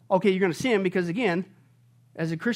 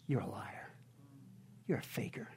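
hushed whisper; 9 semitones lower, 17 dB quieter than usual. Real, not acted. Quiet accusation to false Christians.

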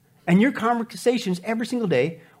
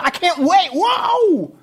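excited; 9 semitones higher, 4 dB louder, much slower than usual. Acted. Acting eager quick listener.

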